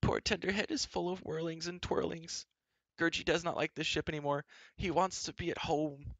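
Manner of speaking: high, plaintive creature voice; pitiful wailing creature. A narrator's reading. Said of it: "Gurgey wailed and howled pitifully".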